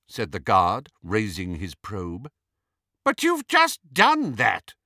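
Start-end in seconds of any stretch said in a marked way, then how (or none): 3.0-4.7 very high, loud imperious protest; Bellatrix, commanding and arrogant. A narrator's reading. Hermione posing as Bellatrix to a guard, commanding, arrogant. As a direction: rap it out high and haughty. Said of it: "in Bellatrix's commanding, arrogant voice".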